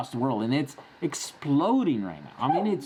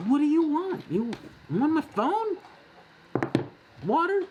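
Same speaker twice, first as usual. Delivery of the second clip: much higher, coaxing pet voice; high dog-directed question; 12 semitones higher than usual. Real, not acted. Host asks his pushy dog what it wants, high and coaxing.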